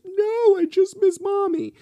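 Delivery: put-on very high, loud childlike wail; imitating crying son. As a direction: mimic a tearful little boy at full pitch. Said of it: "And he's crying".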